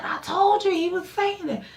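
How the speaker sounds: high, far-off told-you-so; squeaky vindicated retort. Retold or imitated. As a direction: sing it out like I was right all along.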